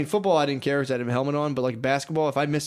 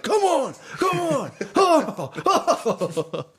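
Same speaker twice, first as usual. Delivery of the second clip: intense coach in bed; 12 semitones higher, 4 dB louder, much slower than usual. Pretend. Joking how a hyped coach acts with his wife, a high strained mock cry. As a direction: pretend coach straining, very high and loud, breaking into a laugh. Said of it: "full fucking sweat".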